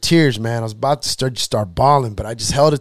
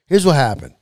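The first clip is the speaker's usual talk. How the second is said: ordinary talk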